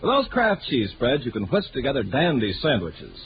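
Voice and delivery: ordinary talk, nothing marked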